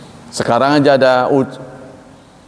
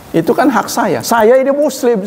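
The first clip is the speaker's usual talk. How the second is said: insistent; 9 semitones higher than usual. Retold or imitated. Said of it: "saya bilang".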